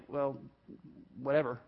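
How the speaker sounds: low, quiet brush-off; dismissive. Acted. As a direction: shrug it off under your voice. Acts out a dismissive shrug.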